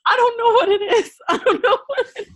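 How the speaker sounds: high, laughing helpless answer. Real, not acted. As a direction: say it while cracking up. Laughing after being teased.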